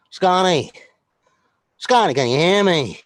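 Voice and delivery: loud call into the call. Real, not acted. Calling out to his trainer who isn't connected. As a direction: call his name out to reach him.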